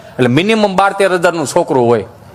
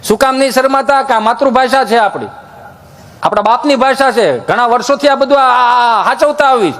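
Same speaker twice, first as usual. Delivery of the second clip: higher, preachy village elder voice; village guru preaching; 5 semitones higher than usual. Acted. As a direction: preach like a rustic guru to the crowd. Village guru sermon persona. (ગામડાના બાપજી જેવો ઉપદેશ)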